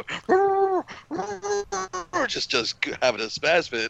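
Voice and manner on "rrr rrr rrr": high, yappy mock growl of a confused dog; dog growling imitation. Telling how the dog Oliver freaked out, high mock dog growls. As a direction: growl like a spooked dog that won't recognize you.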